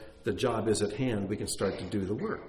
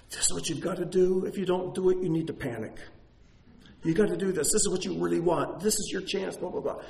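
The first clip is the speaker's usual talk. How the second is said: higher, urgent, pressing inner voice; pushy worldly voice; 8 semitones higher, faster than usual. Retold or imitated. Pastor voicing the physical's voice, high and urgent. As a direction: nag like a voice that won't let you rest. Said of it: "we'll have a voice coming at us".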